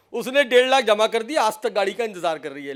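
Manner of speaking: usual speaking voice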